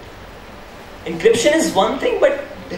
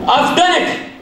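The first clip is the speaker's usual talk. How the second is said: excited, higher exclaim; triumphant; 6 semitones higher than usual. Acted. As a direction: cheer your success. Hacker's triumph.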